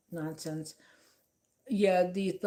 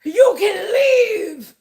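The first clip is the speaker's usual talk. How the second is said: much higher, much louder and slow, a hammed-up reality-TV lady; mock TV lady voice; 19 dB louder, much slower than usual. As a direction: shriek it out like an over-the-top Bravo diva. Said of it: "she just disguises her voice".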